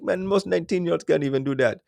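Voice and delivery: put-on high Jamaican accent; Jamaican buddy. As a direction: imitate a Jamaican friend's praise. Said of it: "my Jamaican buddy".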